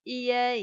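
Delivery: "I E" read out loud, letter by letter; spelled-out sounds. Shown as a demonstration. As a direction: spell "I E" firmly for the class. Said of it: "âm chính". (đánh vần to, rõ "I E")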